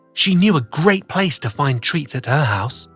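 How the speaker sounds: ordinary delivery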